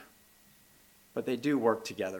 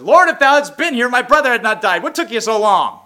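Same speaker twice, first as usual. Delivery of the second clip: much higher and louder, accusing; indignant Martha; 10 semitones higher, 14 dB louder than usual. Retold or imitated. Martha scolding Jesus for arriving late. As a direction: demand it like a hurt, impatient sister. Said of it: "when Martha came to him".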